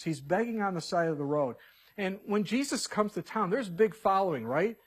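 normal talking voice

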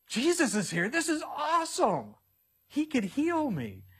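high, thrilled cry of sudden hope; excited beggar. Acted. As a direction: burst out with new hope, a bit slowed at the end. Blind man hears Jesus is near.

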